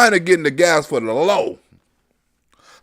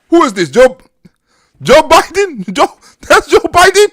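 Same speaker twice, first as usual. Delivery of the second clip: laughing mock Putin; 9 semitones higher, 7 dB louder than usual. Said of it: "Putin seeing the video".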